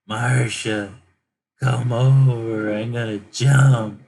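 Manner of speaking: loud, slow, drawn-out mock wail; desperate calling out. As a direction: call out as if threatening to jump, hammed-up. Acts out desperate man calling Marsha.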